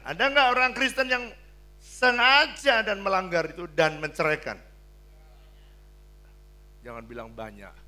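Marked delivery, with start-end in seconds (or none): 0.0-4.6 loud, high challenge; preaching at full force. Acted. Preacher asking about divorce, loud and high. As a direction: throw the question at the crowd.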